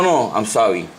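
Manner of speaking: normal talking voice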